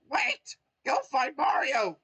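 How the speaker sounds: very high, loud urgent cry; sudden realization. Acted. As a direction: blurt it out as the idea hits. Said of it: "he realized".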